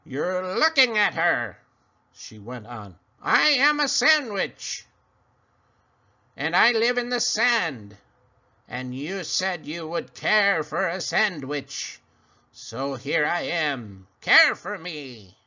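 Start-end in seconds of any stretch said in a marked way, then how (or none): 0.0-1.6 cackling creature. Acted. Creature reveals herself. 3.2-15.4 cackling creature. Acted. Creature speaks.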